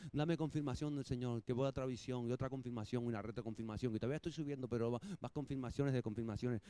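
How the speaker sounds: low, soft mumbled prayer; mock pleading. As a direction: imitate someone endlessly asking for reassurance, under the breath. Acting hesitant Abraham begging confirmations.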